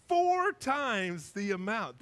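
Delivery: FOUR held long and strong; slow, drawn-out climax on FOUR; climactic emphasis. Acted. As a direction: stretch FOUR out as the biggest offer. Zacchaeus's offer escalates to climax.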